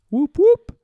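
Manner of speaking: high, loud whooping noise; siren whoop. Shown as a demonstration. Imitating hydraulic sound.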